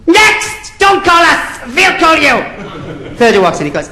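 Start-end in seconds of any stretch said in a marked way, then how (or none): 0.1-2.4 very high, dismissive interviewer; Nicky Lauder. Acted. Airline boss dismissing the first applicant, brusque. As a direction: send them away in a high, stretched-out voice. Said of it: "And Nicky Lauder said".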